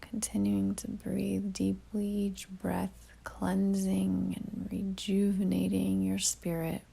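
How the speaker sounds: calm meditation delivery with long pauses; guided meditation. Real, not acted. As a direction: ease the listener inward, leaving long silences between phrases.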